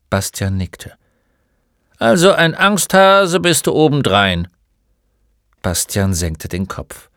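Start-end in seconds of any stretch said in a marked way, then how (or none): 2.0-4.5 loud, high-pitched jeer; scornful taunt. Acted. Old shopkeeper sneers at the frightened boy, loud and high. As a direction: needle him for his timidity.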